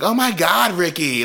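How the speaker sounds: high, annoyed groan of his name; exasperated wife. Retold or imitated. Bus driver fed up with her husband Ricky, high exasperated. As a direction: brush off the nagging husband. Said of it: "She's like".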